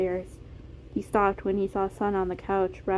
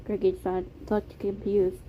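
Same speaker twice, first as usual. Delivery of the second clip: ordinary delivery, nothing marked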